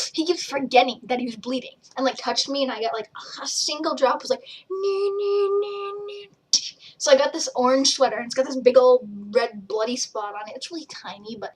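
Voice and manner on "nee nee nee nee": higher squealing nee nee; mock squeal. Squealing about the friend's blood drop, higher squeal.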